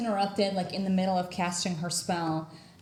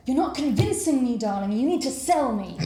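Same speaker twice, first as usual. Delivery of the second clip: theatrical professor; 5 semitones higher, 5 dB louder than usual. Acted. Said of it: "and she says".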